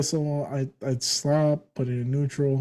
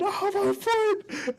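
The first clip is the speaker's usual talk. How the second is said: long, drawn-out high-pitched wail; mock yelling; much slower than usual. Imitating brother whose foot got run over, mock yell. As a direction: stretch it into a comic high cry of pain. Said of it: "just yelling".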